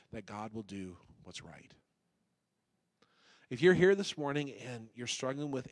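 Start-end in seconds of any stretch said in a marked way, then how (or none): 0.1-1.7 soft whispered plea; hushed pastoral whisper. Acted. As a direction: breathe the line like a secret. Soft close of sermon plea.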